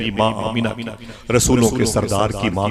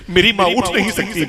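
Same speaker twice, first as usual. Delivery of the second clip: higher, louder child's plea, acted; imploring, pitch raised high; pleading; 9 semitones higher, 4 dB louder than usual. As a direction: plead for your mother, voice lifted. Child pleads for sick mother.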